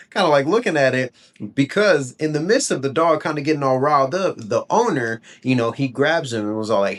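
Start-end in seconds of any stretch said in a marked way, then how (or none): none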